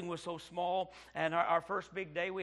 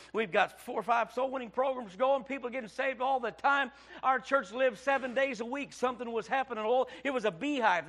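higher, building louder toward a shout; full-force preaching; 6 semitones higher, 10 dB louder than usual. Real, not acted. Preacher recounting his wife's illness, building indignation. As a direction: rail at the unfairness, building. Story told in preaching fervour.

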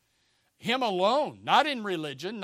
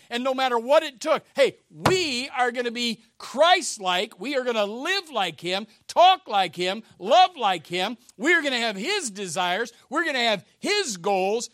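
louder, building preacher's call to live like Christ; rousing preaching; 5 semitones higher, 8 dB louder than usual. Acted. Pastor urging his congregation, loud rousing preaching. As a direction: preach it out full force to the church.

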